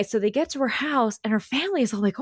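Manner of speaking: normal talking voice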